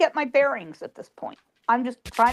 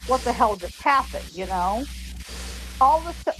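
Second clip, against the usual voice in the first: normal talking voice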